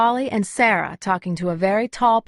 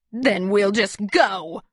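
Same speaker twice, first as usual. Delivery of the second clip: slow, cold fury; furious; slower than usual. A narrator's reading. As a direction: spit it out in anger, slowly. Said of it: "fury in her voice".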